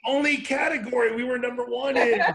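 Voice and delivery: loud, high, through laughter; laughing admission. Real, not acted. Guest confessing dubious record to amused hosts, laughing loudly. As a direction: own up to it with a big laugh. Joking about the only top ranking.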